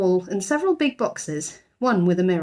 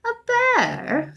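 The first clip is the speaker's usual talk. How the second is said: doubtful. A narrator's reading. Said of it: "said Missus Bird doubtfully".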